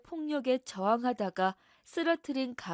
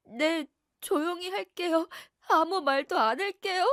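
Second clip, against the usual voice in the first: tearful submission; 6 semitones higher, 5 dB louder than usual. Acted. Frightened wife promising husband silence, higher teary voice. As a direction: whimper a frightened yes.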